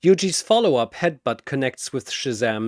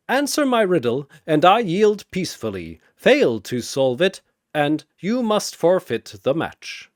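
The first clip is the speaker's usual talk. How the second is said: put-on higher, louder old brewmaster; sly wager; 5 semitones higher, 4 dB louder than usual. Chen smiling as he proposes the riddle bet. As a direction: offer the deal with a smile.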